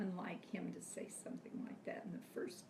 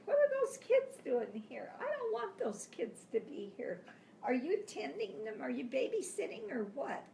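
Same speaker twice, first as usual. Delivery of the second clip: imitating a cross husband, higher voice; mock irritated questioning, pitched up; indignant retold complaint; 5 semitones higher, 5 dB louder than usual. Husband to wife about kids by TV, mildly indignant.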